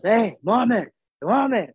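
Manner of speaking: high, loud call; guard calling him back loudly; yelling a name. Retold or imitated. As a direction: shout the name across a crowd. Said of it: "I hear somebody yelling".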